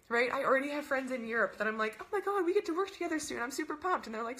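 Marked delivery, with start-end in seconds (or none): 2.0-4.1 high-pitched, quick excited gush. Retold or imitated. Coach quoting herself to European friends, excited gushing. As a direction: gush like thrilled to work with friends.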